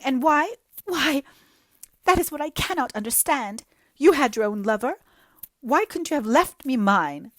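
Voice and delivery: high, loud, self-pitying lament; jilted girl pouring out woe to Anne; melodramatic young woman. Acted. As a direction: wallow in every word like a tragic heroine.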